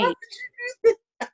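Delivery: high, whining plea; hammed-up high wail; mock despair. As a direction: beg the friend not to go. Continues the mock despair at the friend.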